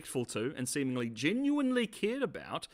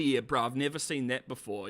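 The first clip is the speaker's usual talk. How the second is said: ordinary talk, nothing marked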